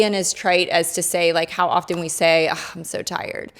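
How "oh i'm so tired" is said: soft, sighed-out complaint; mock weary. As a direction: act out a tired little grumble, softly. Voicing a common weary complaint.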